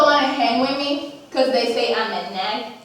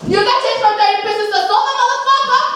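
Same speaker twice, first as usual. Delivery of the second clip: taunting yell; 6 semitones higher than usual. Retold or imitated. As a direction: rub it in his face. Taunting Judas.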